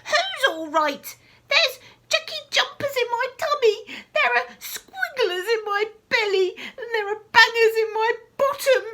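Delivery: put-on high, loud wailing old woman; loud high rant; ranting grandma. A narrator's reading. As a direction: wail about the bangers. Grandma ranting about her belly.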